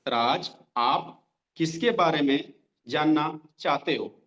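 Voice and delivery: slow, word by word, with long pauses; slow dictation. Shown as a demonstration. Trainer dictating a sales script to trainees, slowly. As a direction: spell the line out slowly so they can write it. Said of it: "slow slow बोल रहा हूँ".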